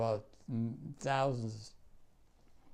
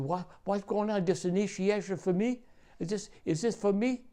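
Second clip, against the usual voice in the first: higher-pitched, confused questions; retold startled questioning, pitched up; bewildered newcomer; 6 semitones higher than usual. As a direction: imitate a kid woken up bewildered. Kid woken half naked, confused.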